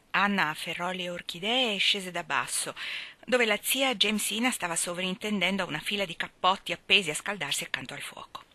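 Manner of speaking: usual speaking voice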